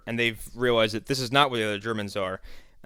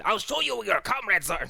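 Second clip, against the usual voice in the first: higher, oddly sly servant voice; servant's voice; 9 semitones higher than usual. Retold or imitated. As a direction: redo the line in the servant's put-on voice. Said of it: "I love doing that guy's voice".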